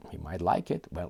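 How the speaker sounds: ordinary delivery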